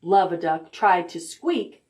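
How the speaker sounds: plain reading voice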